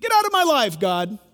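shouted high and loud, furious; angry yell. Retold or imitated. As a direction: hurl it at God in anger. Quoting an angry person to God.

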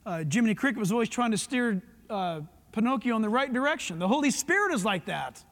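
normal talking voice

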